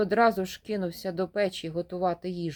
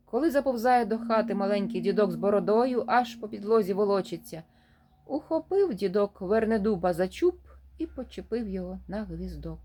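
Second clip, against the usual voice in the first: usual speaking voice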